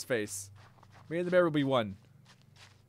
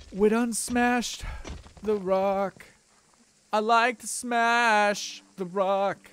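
higher, louder, slow dumb caveman; 6 semitones higher, 5 dB louder, much slower than usual. Pretend. Gamer smashing rocks as a caveman, slow and loud. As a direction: boom it out like a happy brute.

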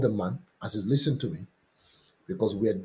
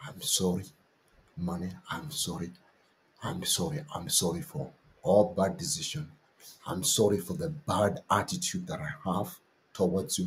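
quiet prayer; 3 semitones lower, 5 dB quieter than usual. Shown as a demonstration. Pastor leads soft prayer affirmations, gentle and low. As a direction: pray it low and tender.

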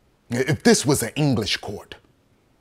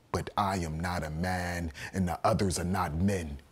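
low, near-whispered gravity; quiet, low, grave admission; hushed statement; 6 semitones lower, 12 dB quieter than usual. Acted. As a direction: say it softly, deep and restrained.

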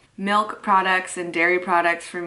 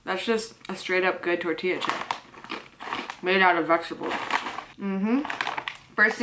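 talking while chewing. Real, not acted. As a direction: mouth partly full of chip. Seen 2: Bites chip at -2 and 4.7, chews while talking throughout.

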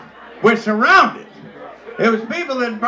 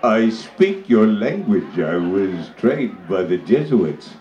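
deep chief voice; slower than usual. Acted. Chief greeting the captured missionary, very low and slow. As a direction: rumble it out like a big chief.

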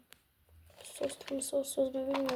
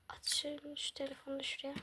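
whisper; 6 dB quieter than usual. Acted. Quiet morning packing.

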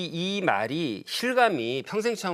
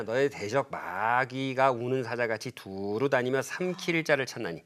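scripture reading; 3 semitones lower, 3 dB quieter than usual. Acted. Quoting scripture solemnly.

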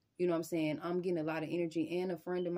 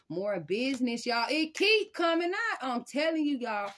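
much higher and louder, thrilled; excited outburst; 7 semitones higher, 7 dB louder than usual. Real, not acted. Reader thrilled as the business card keeps appearing, loud and high. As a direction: blurt it out as the card pops again.

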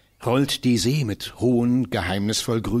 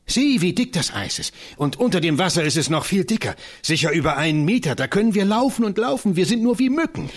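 much higher, quick, swelling delight; excited boasting; 7 semitones higher than usual. Acted. Johannes boasts to Christiane on the ice, exhilarated. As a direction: brag with wild joy.

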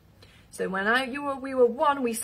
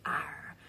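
pirate growl; 11 dB quieter than usual. Pretend. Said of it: "because we're pirates today".

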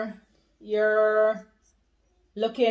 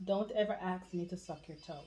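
whisper; 4 semitones lower, 12 dB quieter than usual. Acted. And don't talk too loud.

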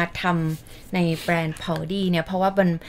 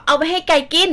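quoting dad; 9 semitones higher, 6 dB louder than usual. Retold or imitated. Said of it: "พ่อก็เลยบอกว่า".

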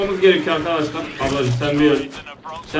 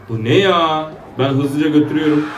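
ordinary talk

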